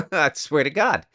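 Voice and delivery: laughing. Real, not acted. Laughs at own joke.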